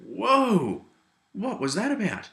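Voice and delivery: high, loud startled whoa, then a baffled question; stunned whoa. Retold or imitated. Friends stunned after being confronted, high and startled. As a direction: act out the group's shock after the confrontation. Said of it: "we all looked at each other and went".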